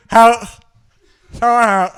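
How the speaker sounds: loud, high muffled help; mock cry for help. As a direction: call for help, hammed-up and loud. Acting stuck with gasket.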